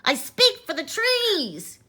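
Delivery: loud, high cry; Lorax crying out. Acted. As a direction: cry it out in protest. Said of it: "cried the Lorax".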